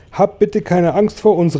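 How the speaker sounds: put-on high-pitched boy calling down. A narrator's reading. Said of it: "rief James". (mit hoher Stimme rufen)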